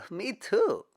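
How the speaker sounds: laughing delight; happy laugh. Acted. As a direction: laugh then answer high and bright. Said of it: "broke into a huge smile".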